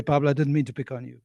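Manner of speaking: ordinary delivery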